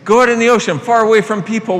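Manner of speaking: urging the crowd, emphatic; emphatic insistence. Shown as a demonstration. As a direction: insist on it with energy.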